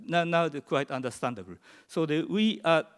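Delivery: normal talking voice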